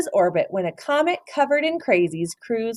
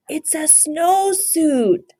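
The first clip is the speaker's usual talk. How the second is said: slow, higher, firm correction; declared; 9 semitones higher, 3 dB louder, much slower than usual. A narrator's reading. Scipito corrects Don Diego, slowly declaring. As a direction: insist on it, slowly. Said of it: "declared Scipito".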